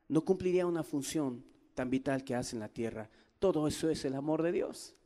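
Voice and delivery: hushed, tender sermon voice; soft intimate preaching. Acted. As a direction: lean in close and speak quietly to the congregation.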